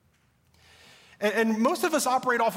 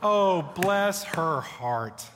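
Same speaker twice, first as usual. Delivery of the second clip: a very slow, syrupy Southern "Oh, bless her heart"; fake-sweet Southern "Oh, bless her heart"; Southern sweetness; much slower than usual. Shown as a demonstration. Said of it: "You know what I'm talking about?".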